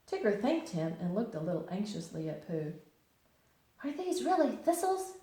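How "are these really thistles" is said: usual speaking voice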